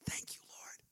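unvoiced, hushed thank you; whispered prayer. Real, not acted. Preacher whispering thanks to God, hushed. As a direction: breathe the words as a quiet prayer.

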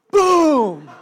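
loud, high-pitched explosive boom; big booming impact sound; shouted boom. Acted. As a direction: burst out with a crashing door-kick noise. Acts out door being kicked.

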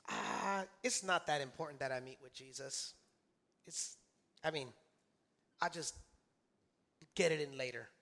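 low, soft, halting mock excuse; sleepy excuse-making. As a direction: imitate someone lazily putting it off. Acts out lazy excuse for sleeping in.

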